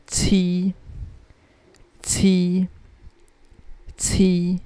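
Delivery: Qi as chee, held high and level; first tone. Shown as a demonstration. Teacher reading number seven, Qi in high level first tone. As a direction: hold Qi on a steady high note. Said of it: "the first tone".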